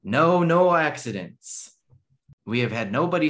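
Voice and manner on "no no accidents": slow, loud stress on "No, no"; emphatic no. Retold or imitated. Reading the note aloud, stressing no accidents with slow emphasis. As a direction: lean hard on "No, no accidents" slowly.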